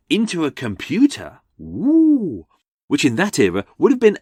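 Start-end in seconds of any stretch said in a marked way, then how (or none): 1.6-2.5 long, high swooping "ooh"; mock-awed "ooh". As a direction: coo in sarcastic wonder. Mock awe at a computer.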